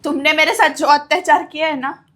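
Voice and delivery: loud, accusing, finally speaking out; a widow loudly accusing her abuser in front of the family; loud accusation. Acted. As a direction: hurl the accusation at him.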